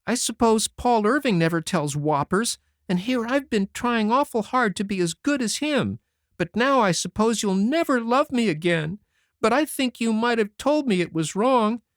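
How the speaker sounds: put-on high boyish voice, crying as he pleads; a sorry child weeping out his excuses; tearful. A narrator's reading. Davy crying on Anne's shoulder, begging forgiveness tearfully. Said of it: "cried stormily".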